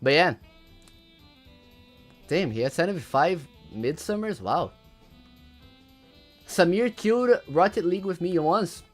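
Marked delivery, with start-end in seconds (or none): none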